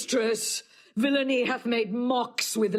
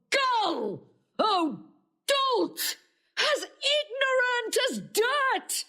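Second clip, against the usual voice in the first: much higher, crying rage; furious outburst; 11 semitones higher than usual. Acted. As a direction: hurl the insults at him. Emilia raging at Othello.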